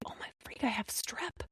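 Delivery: whispered in dismay, breath only; shocked whisper. Retold or imitated. Mom realizing her sore throat, whispered alarm. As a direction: hush it like a scary realization.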